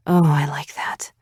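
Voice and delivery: breathless whisper in his ear; breathy whisper. A narrator's reading. Whispered during the kiss.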